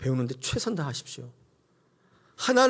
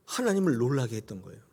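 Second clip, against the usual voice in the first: ordinary delivery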